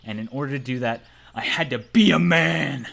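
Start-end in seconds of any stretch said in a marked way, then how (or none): 1.3-2.9 loud, slow rousing vow; loud, slow hammed-up resolve; mock heroic declaration.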